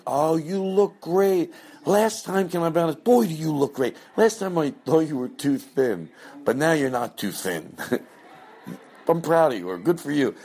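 high over-the-top flattering hostess; gushing fake compliments. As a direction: lay on the flattery thick. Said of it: "but it's very like".

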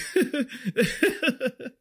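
high-pitched stifled giggle; imitated giggling. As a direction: re-enact a helpless little giggle. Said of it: "start giggling".

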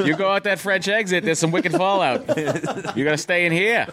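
high, hammed-up Boston accent; Boston accent with laughter around it. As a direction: play a worked-up Bostonian giving directions. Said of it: "I talk like that".

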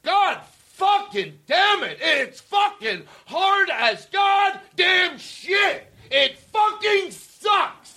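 yelling. Retold or imitated. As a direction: bellow it out slowly, way up high. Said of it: "take what I was yelling".

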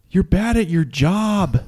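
high, slow mock yell at the ref; mock heckle. Co-host imitates personal jabs at refs, drawn-out mock heckle. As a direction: draw out an imitated fan's jeer. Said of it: "the screaming at the ref".

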